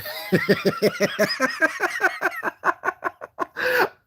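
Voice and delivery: high burst of real laughter. Real, not acted. Vlogger laughing at her own rant, bursting out high.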